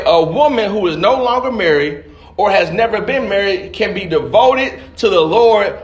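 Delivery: ordinary talk, nothing marked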